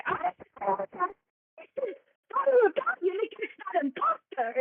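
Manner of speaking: high, theatrical hatter voice; high Mad Hatter voice. Acted.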